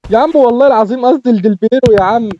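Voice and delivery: high, loud swearing he meant it; loud excited insistence. Real, not acted. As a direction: shout it out, thrilled. Excited insistence after a goal.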